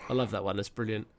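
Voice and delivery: ordinary delivery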